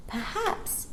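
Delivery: polite. Acted. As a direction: ask hopefully. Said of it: "Jane said politely".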